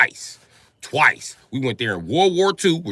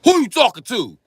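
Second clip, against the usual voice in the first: aggressive threat; 5 semitones higher, 4 dB louder than usual. Retold or imitated. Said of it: "He turned around and punched Britain".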